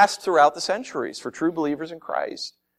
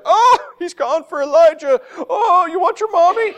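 high sneering mock voice; high-pitched jeering taunt; mocking crowd; 5 dB louder than usual. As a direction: taunt him like a jeering bystander. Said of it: "the people in the crowd are just like".

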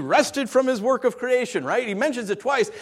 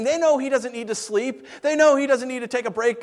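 ordinary talk, nothing marked

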